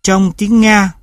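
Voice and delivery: normal talking voice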